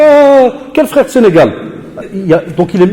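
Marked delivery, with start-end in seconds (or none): 0.0-1.5 high, loud outraged cry of surprise; shocked outburst. Retold or imitated. Teacher imitating a pupil's shocked outrage. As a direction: jump up in pitch, offended and startled. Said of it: "Il fait".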